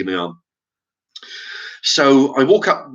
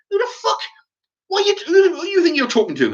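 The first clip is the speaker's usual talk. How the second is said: much higher, incredulous challenge; retold outraged retort. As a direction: throw it back, pitch up high. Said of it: "And I'm going".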